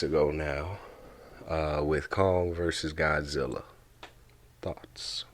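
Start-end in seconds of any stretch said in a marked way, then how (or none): 4.6-5.2 breathy whispered question. Acted. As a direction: drop to a whisper.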